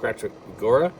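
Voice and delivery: ordinary delivery, nothing marked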